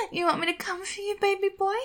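ordinary talk, nothing marked

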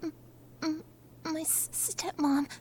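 low, soft, halting undertone; stammered near-whisper; hushed. Acted. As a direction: keep your voice down so the next room won't hear. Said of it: "I have to keep my voice down".

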